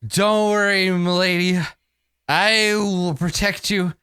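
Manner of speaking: high, drawn-out vow to a lady; slow, high character voice; gallant drunk protector. Acted. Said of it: "he says quietly".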